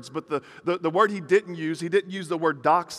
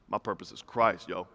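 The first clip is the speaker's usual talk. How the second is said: low, cool, self-assured delivery; mock confident; 9 semitones lower, 5 dB quieter than usual. Churchgoer answering about life purpose, mock confident. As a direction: say it low, as if totally sure. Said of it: "say it real confidently".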